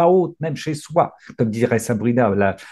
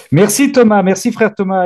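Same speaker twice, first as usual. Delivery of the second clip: loud thanks; 9 semitones higher, 8 dB louder than usual. Real, not acted. Loud thanks to brother Thomas.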